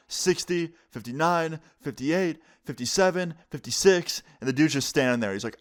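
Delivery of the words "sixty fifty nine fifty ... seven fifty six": higher-pitched, measured count; countdown. Retold or imitated. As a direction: count down as an ultimatum, number by number. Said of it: "starts counting down from sixty".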